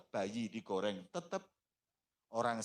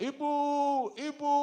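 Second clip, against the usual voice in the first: much higher frightened cry for mother; screaming child; 6 dB louder than usual. Acted. The son crying to his mother while burned, high frightened cry. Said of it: "Teriak-teriak".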